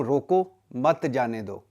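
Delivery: روکو on its own, pause, then مت جانے دو together; slow, comma placed after روکو. Shown as a demonstration. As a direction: break the phrase right after روکو. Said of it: "مطلب روک لو".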